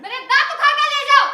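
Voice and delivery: loud, high sarcastic retort; bitter outburst. Acted. Sister-in-law pressed for grocery money, bitter outburst. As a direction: fling the demand back in fury.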